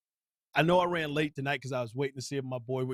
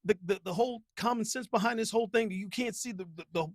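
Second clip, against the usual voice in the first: high, stammering incredulity; mock exasperated voice, much higher; exasperated disbelief; 9 semitones higher than usual. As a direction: stutter in disbelief, pitched up high. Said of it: "I was like".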